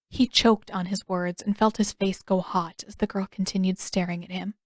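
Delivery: usual speaking voice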